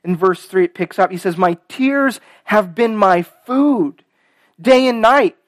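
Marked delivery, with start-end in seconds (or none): 1.4-5.4 heartfelt pulpit reading, pushed louder; scripture reading. Acted. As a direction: read out the lament with strong, stretched key words. Reads the psalm aloud.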